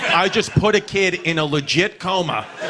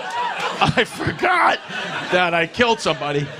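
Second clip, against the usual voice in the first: hammed-up excuse. Expelled kid excuses himself to Brother Rob, hammed-up innocence. Exaggerated repeat of his excuse.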